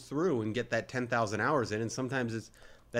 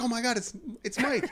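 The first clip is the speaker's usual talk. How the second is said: high, starstruck squeal of recognition; thrilled; 11 semitones higher than usual. Retold or imitated. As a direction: imitate a fan spotting a celebrity, pitched way up. Exterminator thrilled at spotting Mike.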